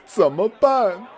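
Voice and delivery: high, slow, fretful question; whiny worried diner. Acted. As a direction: plead helplessly for advice.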